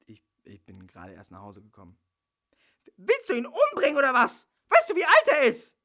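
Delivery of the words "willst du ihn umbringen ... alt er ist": higher, louder furious questions; furious accusation; angry shout. Acted. Bella attacking Hannes.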